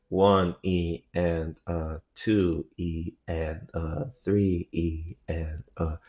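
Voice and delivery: very slow, even beat count. Shown as a demonstration. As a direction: count out each subdivision slowly and softly. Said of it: "going thirty beats per minute".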